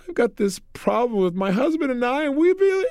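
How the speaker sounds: rising, high-pitched imitation of a sobbing wife; mock crying. Woman telling the seminar leader of marriage trouble, mock crying. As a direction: break into exaggerated tearful wailing. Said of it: "she began to cry like crazy".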